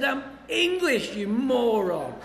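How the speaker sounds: slow, high, laughing mock outrage; mock-indignant correction of the cops; indignant retort. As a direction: drag it out, offended and amused. Retort to cops calling him Irish.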